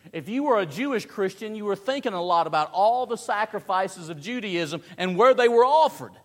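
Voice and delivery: high, building to a loud finish; forceful preaching. Real, not acted. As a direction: build to a pulpit climax.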